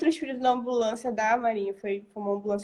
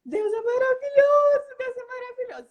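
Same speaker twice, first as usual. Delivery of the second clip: tearful praise; 13 semitones higher, 5 dB louder than usual. Retold or imitated. Crying mother answers a stranger about God, in a tearful wail. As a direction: re-enact wailing praise, pitched way up and fading. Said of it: "E eu chorando".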